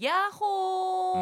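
high, long mountaintop call; shouted yoo-hoo. Retold or imitated. Hiker atop a high mountain, long echo-style call. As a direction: call out and hold the last sound. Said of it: "야호 하고 소리쳤습니다".

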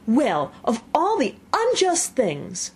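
slow, indignant outburst. A narrator's reading. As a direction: splutter with outrage. Seven indignant.

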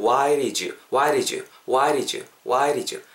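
"why did you" as "why-ri-joo"; "why did you" linked, with a flapped D; D softened to R. Shown as a demonstration. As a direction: tap the first D of did like a Korean ㄹ in "why did you". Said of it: "디귿 정도 사운드 되는 부분이 리을로 바뀌면서".

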